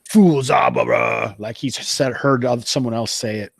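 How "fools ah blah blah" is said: loud, drawn-out exclamation falling away; mocking wizard's foe. Game master voices the large laughing guy, loud put-on voice. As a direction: boom it out, then trail into blah-blah. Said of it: "and he says something".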